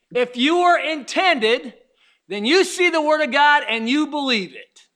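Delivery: rising preacher cadence, building force; preaching. Acted. Preacher building to the congregation.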